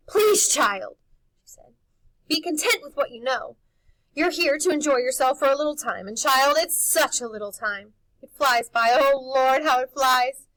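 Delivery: high, loud anguished pleading; desperate plea. Acted. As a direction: beg him to let it go. Housekeeper pleading, shaking him.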